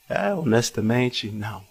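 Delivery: sheepish admission. Retold or imitated. Admitting he forgot her.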